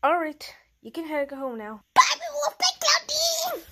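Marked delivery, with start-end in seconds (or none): 1.9-3.6 put-on high child's goodbye.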